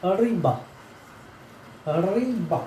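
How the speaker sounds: रिबा with a tiny pause before the end; teacher models रिबा, showing the little stop; pronunciation demo with a short stop. Shown as a demonstration. Teacher shows the Russian hard sign, pronunciation demo with a pause. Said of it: "छोटा सा रुकता है".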